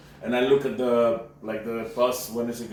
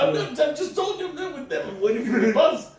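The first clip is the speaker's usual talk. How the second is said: laughing protest; 4 dB louder than usual. Retold or imitated. Narrator's own retold reply, laughing.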